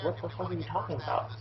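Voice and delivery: high, hushed mock disbelief; retold incredulity. Retells own reply to teacher.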